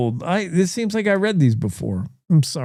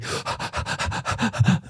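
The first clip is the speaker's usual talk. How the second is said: long airy sigh after a run-on sentence; breathless mock sigh; 4 semitones higher, 8 dB quieter than usual. Host sighs after reading a long sentence, breathless sigh. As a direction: exhale like you ran out of air. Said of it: "Let me catch my breath".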